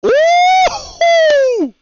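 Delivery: loud high falsetto whoop; high excited whoop. Acted. Crowd reacts to auction.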